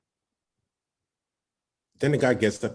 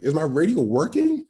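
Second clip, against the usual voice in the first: panicky; 7 semitones higher, 5 dB louder than usual. Retold or imitated. Panicking over a dead radio.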